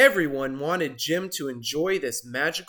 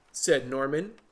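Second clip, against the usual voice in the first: ordinary delivery, nothing marked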